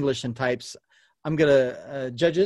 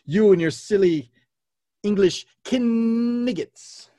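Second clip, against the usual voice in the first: loud, higher-pitched theatrical insult; hammed-up film taunt; taunting French knight; 7 semitones higher, 5 dB louder than usual. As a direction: hurl it mockingly, stretching the last word. Quotes French knight's taunt in quiz.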